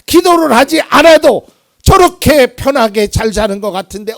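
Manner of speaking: high, loud, indignant complaint to God; bursting frustration. Pretend. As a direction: let the resentment burst out. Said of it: "막 분통이 터지는 거예요". (분통이 터진 듯한 하소연)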